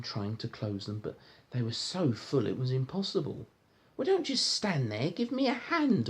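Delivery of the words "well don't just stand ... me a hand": high, bossy order; Mister Uppity ordering. Acted. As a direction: snap orders like a spoilt rich man. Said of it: "ordered Mister Uppity".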